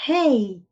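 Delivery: "Hey" with an audible puff of H; English "Hey", H aspirated, not silent. Shown as a demonstration. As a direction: breathe out the English H at the start of "Hey". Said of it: "o H em inglês tem esse som aspirado".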